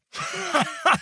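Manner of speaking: shrill howling crazed laugh; wild mad howl-laugh; maniacal laugh. Acted. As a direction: cackle like a madman. Prisoner fakes madness, howling.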